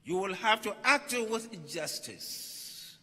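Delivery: ordinary talk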